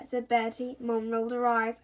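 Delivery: plain reading voice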